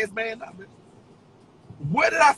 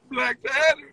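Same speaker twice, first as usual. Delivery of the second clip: ordinary talk, nothing marked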